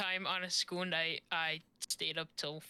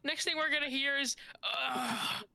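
mocking voice; 9 semitones higher than usual. Mocking friend's noise.

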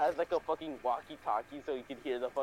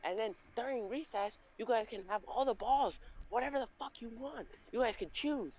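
high, lilting mock-teacher cheer; upbeat, pitched-up generous teacher; delighted teacher; 7 semitones higher than usual. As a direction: hand out treats to the class gleefully. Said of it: "she would just be like".

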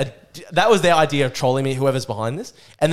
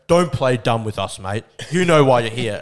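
stern cop; 5 dB louder than usual. Retold or imitated. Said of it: "And then they go".

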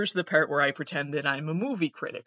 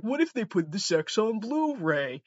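stupid joke voice; 5 semitones higher than usual. Pretend. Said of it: "stupid joke where I'm like".

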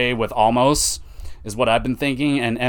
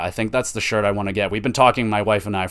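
ordinary talk, nothing marked

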